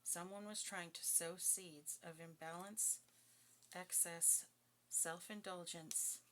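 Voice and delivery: slow, deliberate reading; slow weighty tarot reading; slow emphasis. Real, not acted. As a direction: lay each word down slowly.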